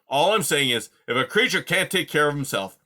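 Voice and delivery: put-on loud grumpy old creature voice; gruff old porcupine. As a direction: grumble like a cranky old porcupine. Aerith speaking.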